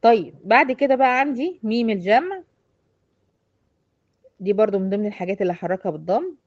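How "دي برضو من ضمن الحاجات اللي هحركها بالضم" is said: usual speaking voice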